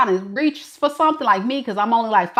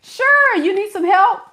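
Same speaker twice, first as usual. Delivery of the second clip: very high, eager mock helper; excited; 8 semitones higher than usual. Man offering a shopper help, comically excited. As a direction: pounce on the chance to help, high-pitched. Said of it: "And then they get excited".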